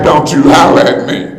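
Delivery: normal talking voice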